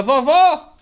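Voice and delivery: loud, rising call to grandma. Real, not acted. Prompting a child to call grandma.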